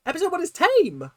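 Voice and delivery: high incredulous outburst; disbelieving yelp of outrage. Real, not acted. Mock outrage at viewers' claim.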